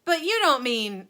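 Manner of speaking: loud high protest; alarmed shout. A narrator's reading. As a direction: shout in disbelief. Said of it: "shouted Grandpa Joe".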